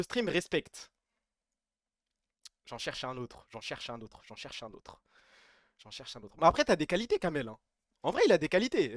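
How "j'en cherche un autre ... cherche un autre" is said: each repeat quieter until barely there; fading to nothing. Acted. Streamer trailing off the joke, fading softer each time. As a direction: let the line die out as you repeat it.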